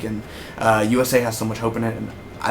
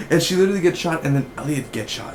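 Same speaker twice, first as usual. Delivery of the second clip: usual speaking voice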